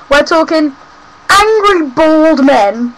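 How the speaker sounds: ordinary delivery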